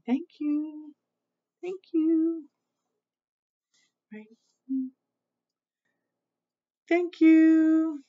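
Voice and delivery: high, held-out polite thank-you; sweet singsong thanks. Shown as a demonstration. Reader shows children how to thank people, sweet high singsong. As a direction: model good manners in a high lilt.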